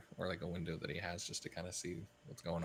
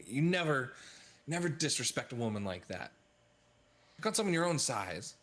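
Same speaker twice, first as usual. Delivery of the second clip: raised, higher-pitched reprimand; acted righteous telling-off, voice pushed up; indignant hero scolding; 6 semitones higher, 4 dB louder than usual. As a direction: scold the thug while shielding someone. Player voices hero confronting thug.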